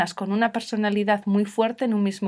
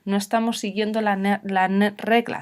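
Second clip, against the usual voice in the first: normal talking voice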